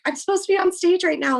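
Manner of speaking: desperate to get on stage in time; retold panic at missing her stage call; panicked plea. Panicking to backstage staff.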